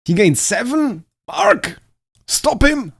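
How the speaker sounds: high, loud urgent yells to a teammate; frantic shouting. Real, not acted. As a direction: shout the alarm at full volume. Frantic calls during a close race.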